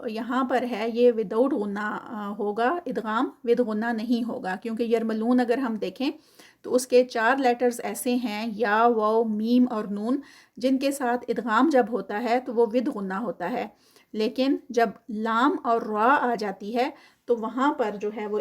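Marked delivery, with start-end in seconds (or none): none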